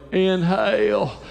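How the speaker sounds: slow, drawn-out Bill Clinton impression. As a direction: breathe it in like Clinton. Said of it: "I just do Bill Clinton and go".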